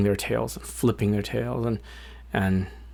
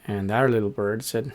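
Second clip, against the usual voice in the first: ordinary delivery, nothing marked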